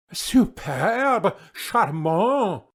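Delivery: high, gushing French praise; mock French court ladies; French exclaim. A narrator's reading. Court ladies praising the nightingale, French gushing. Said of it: "exclaimed the ladies".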